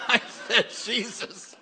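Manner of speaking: high, loud and laughing through the name; can't stop laughing as you say it; laughing retelling. Retold or imitated.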